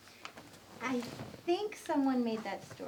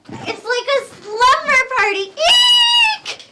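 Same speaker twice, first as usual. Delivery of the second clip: much higher and louder, ending in a shrieked Eek; thrilled squeal; 11 semitones higher, 18 dB louder, slower than usual. Acted. Woman delighted to share the room, squealing with joy. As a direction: squeal like a kid at a sleepover.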